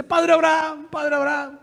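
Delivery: high, held call to someone far off; acted, sustained hailing cry. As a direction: raise the pitch and call across a distance. Re-voices the rich man calling.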